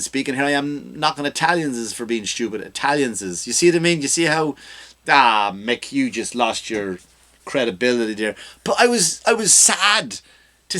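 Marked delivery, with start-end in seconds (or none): none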